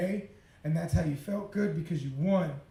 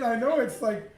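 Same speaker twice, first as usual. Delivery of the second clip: usual speaking voice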